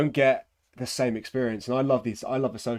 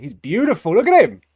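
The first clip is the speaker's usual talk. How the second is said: high, loud delighted cry; thrilled; 12 semitones higher, 13 dB louder than usual. Real, not acted. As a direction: gush over it, bursting with delight. Thrilled by painted miniature.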